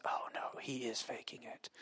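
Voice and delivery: whispered inner panic; hushed, voiceless aside, acted; dread-filled whisper. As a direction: whisper it to yourself in dismay.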